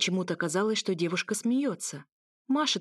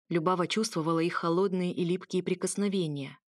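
plain reading voice

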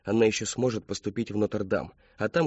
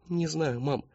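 put-on small, higher young voice; helpless daughter; 7 semitones higher, slower than usual. A narrator's reading. Daughter confiding in her mother, small high voice. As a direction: answer mum helplessly.

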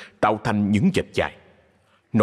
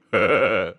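cheerful heh-heh chuckle; merry trader's laugh; hearty chuckle. A narrator's reading. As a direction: chuckle like a pleased dealer. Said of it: "cười hề hề".

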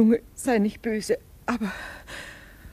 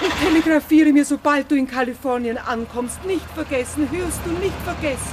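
higher, louder, fast pleading; anxious insistence; 7 semitones higher, 4 dB louder than usual. Acted. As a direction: make him promise, fretting. (aufgeregt drängend)

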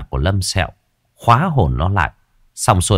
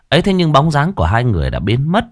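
usual speaking voice

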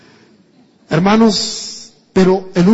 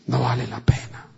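deflated; 6 semitones lower, 7 dB quieter than usual. Retold or imitated. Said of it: "ellos dicen".

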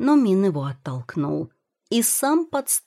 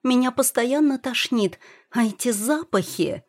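higher-pitched, mildly whiny complaint; tearful; 7 semitones higher than usual. A narrator's reading. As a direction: complain with a touch of tears. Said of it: "всхлипнула Юэ". (плаксивая жалоба)

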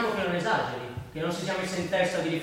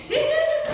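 high burst of laughter; 6 dB louder than usual. Real, not acted. Laughing at old memory.

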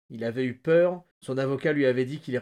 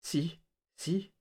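raised-pitch, husky little agreement; hoarse voice; 5 semitones higher than usual. A narrator's reading. Prisoner agrees with Flagg about the key, hoarsely. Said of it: "dit Lloyd d'une voix rauque".

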